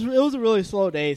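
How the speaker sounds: ordinary delivery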